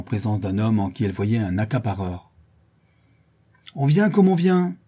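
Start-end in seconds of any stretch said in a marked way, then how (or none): 3.8-4.8 high, loud retort of a ringleader; formidable shout. A narrator's reading. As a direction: bellow it over the mob. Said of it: "cria le charron d'une voix formidable". (cri d'une voix formidable)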